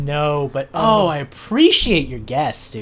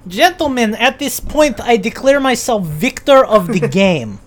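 hint of a Russian accent; grand victory speech in Russian accent; 5 semitones higher, 6 dB louder than usual. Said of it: "can you do it in the Russian accent?".

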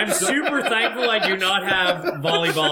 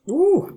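sharp ooh; 12 semitones higher, 4 dB louder than usual. Real, not acted. Reacting to hot sauce.

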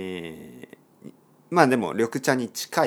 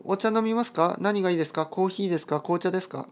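a raised, lightly voiced host offering a choice of drinks; polite host offering drinks; 8 semitones higher, faster than usual. A Japanese friend asks a visiting guest what they want, in a raised, polite host voice. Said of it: "そういう話し方ももちろんあります".

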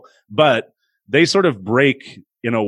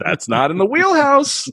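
incredulous scorn. Real, not acted. Mocking Lloyd Webber's disco number.

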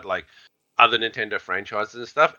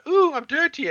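much higher mock princess squeal; girly princess. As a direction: camp up a prissy princess. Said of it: "acts all girly and princessy".

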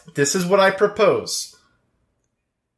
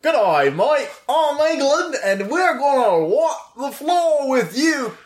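high, mock English accent, laughing at the end; hammed-up English bloke talking trash; bad English accent; 10 semitones higher than usual. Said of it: "I can't do an English accent".

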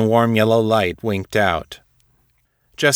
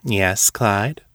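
mischievous. Acted. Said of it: "Tara smiled mischievously".

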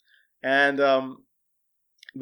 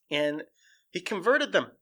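ordinary talk

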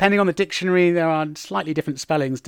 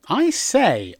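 higher, quaint exclamation; genteel mock astonishment; polite, old-fashioned; 5 semitones higher than usual. Shown as a demonstration. Teacher mimics dated English phrase, politely amazed. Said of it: "very polite and old-fashioned way of saying".

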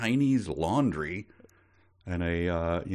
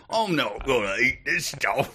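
higher, mock British chimney sweep; chimney sweep accent; 4 semitones higher than usual. Co-host mocks the British impression, chimney sweep accent. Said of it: "is he a chimney sweep?".

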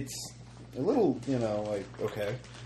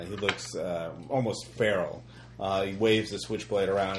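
ordinary talk

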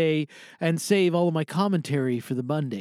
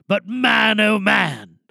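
rallying cry; 5 semitones higher, 6 dB louder, much slower than usual. Acted. Climax of rallying speech.

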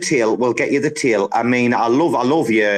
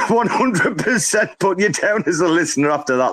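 higher, amused teasing through laughter; laughing; 5 semitones higher than usual. Real, not acted. Host teasing an absent-sounding caller, laughing. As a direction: say it while cracking up. Teasing Bruce after his odd call.

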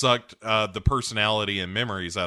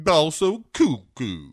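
high, slow, drawn-out cartoon villain; Blofeld; 6 semitones higher, 7 dB louder, slower than usual. Blofeld adding to his story, slow high impression. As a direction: stretch it out with loopy relish. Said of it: "sounds a little like Droopy Dog".

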